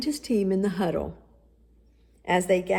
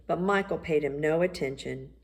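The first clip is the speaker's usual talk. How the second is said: ordinary delivery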